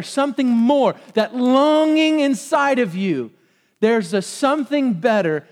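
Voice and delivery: high, loud heartfelt proclamation; impassioned preaching. Real, not acted. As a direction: press the promise home to the listeners. Preaches the answer at full force.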